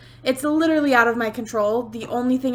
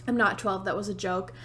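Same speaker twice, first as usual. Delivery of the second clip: quiet aside; 4 semitones lower, 7 dB quieter than usual. Real, not acted. Sheepish aside after voice crack.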